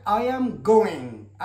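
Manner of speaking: I am going said very slowly; slow model sentence. Shown as a demonstration. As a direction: draw it out for learners. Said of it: "هنا لو وضعنا". (نطق بطيء للجملة)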